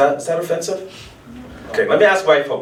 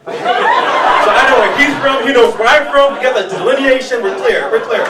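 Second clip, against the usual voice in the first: higher and louder, slowed-down gleeful recognition; excited nod exchange; 9 semitones higher, 4 dB louder than usual. Acted. Comic acting out the nod with the stranger, high and loud. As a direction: ham up the mutual understanding.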